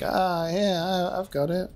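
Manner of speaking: imitating someone bluffing without conviction; stall, then claim it unconvincingly; unsure. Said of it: "He sounded very unsure".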